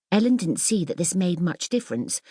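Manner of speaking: ordinary delivery, nothing marked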